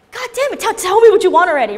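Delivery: high, loud, impatient snap; fed up with waiting, let it burst out; loud exasperation at a friend who won't answer; exasperated outburst. Retold or imitated.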